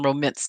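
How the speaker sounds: "romance" said as ro-MINCE; second syllable of "romance" rhymes with mince; mispronounced romance. Retold or imitated. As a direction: say "romance" like "ro-ments". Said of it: "That's how she pronounced it".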